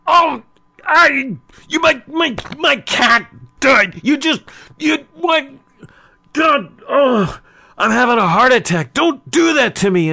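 hammed-up, high-pitched loud outburst of shock; losing it. Man hears his beloved cat died, losing it. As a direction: wail in shocked disbelief, voice shot way up. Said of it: "he just lost it".

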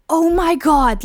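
high, put-on alarmed voice; mock shocked prank caller. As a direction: pretend to panic on the phone. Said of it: "just be like".

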